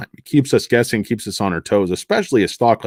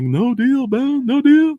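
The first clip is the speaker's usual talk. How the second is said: much higher, strained-up lawyer voice; hammed-up refusal, pitched way up; horrible TV lawyer impression; 13 semitones higher, slower than usual. Lawyer refuses a deal, high mock dramatic voice. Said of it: "horrible Ben Stone impression".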